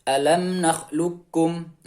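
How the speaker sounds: "nakhluqkum" recited slowly, q passing directly to k; qaf straight into kaf. Shown as a demonstration. Teacher models moving directly to kaf, slow unechoed recitation. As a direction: draw out "nakhluqkum" with no echo after q. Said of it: "Jadi langsung".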